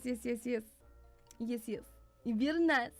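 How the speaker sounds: ordinary delivery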